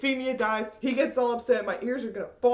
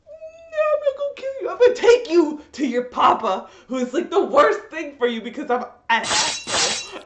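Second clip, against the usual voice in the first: squeaky, much higher mock whine; mocking high whiny voice; 5 semitones higher, 6 dB louder than usual. Fan mocking Suzaku's choice, high and whiny. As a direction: sneer his words in a silly high voice. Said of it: "He's like".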